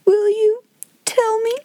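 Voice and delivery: put-on high, halting little-girl plea; whimper. A narrator's reading. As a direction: beg in small tearful pieces, pausing between them. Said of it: "whimpered Lottie".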